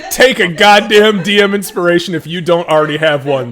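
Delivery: loud, high teasing call. Real, not acted. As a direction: throw it at them, loud and high. Teasing fellow player loudly.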